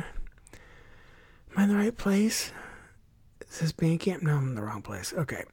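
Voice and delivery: soft, distracted self-talk; muttering to oneself. Real, not acted. Host hunting for the right tab, muttering to himself. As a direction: think aloud under your breath while searching. Checks tabs, talking to himself.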